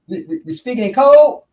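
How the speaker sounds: high, loud puzzled question; acted confused demand; mock bafflement. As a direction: stumble into an incredulous question. Acted baffled question while blinking.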